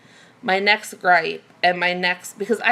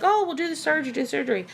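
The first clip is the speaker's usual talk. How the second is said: higher, quick mimicry of advice; glib advice-givers; 7 semitones higher, much faster than usual. Everybody pushing weight loss surgery, imitated in higher pitch. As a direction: toss it off like easy advice. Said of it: "everybody's be like".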